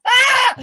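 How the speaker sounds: loud high scream; terrified scream. Retold or imitated. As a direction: scream as you leap out. Screaming while leaping from plane.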